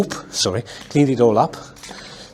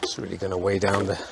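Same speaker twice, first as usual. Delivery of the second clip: ordinary talk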